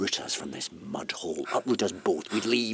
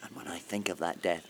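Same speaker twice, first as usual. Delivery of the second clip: usual speaking voice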